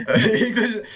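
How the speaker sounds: normal talking voice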